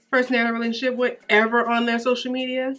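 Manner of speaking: normal talking voice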